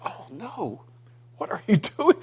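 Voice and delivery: a father horrified at what he sees, half laughing; retelling a dream, caught between alarm and a nervous laugh; alarmed inner cry. Retold or imitated. Father watching son in dream.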